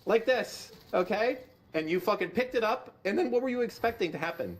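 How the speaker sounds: high, indignant, worked-up complaint; exasperated rant. Real, not acted. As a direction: defend yourself, fully exasperated.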